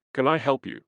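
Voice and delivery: a hint of polite English accent in the quote; best English accent. A narrator's reading. Friend offering help after dinner, mild English accent. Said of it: "in his best English accent".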